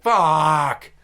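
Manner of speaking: loud, drawn-out mock curse; acted-out groaning expletive; retold frustrated curse. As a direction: let out an exasperated swear. Retold reaction to taco news.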